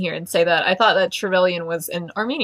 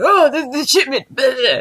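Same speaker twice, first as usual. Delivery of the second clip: high, louder dismissive babble; mock businessman chatter; 6 semitones higher, 4 dB louder than usual. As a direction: rattle off corporate jargon mockingly.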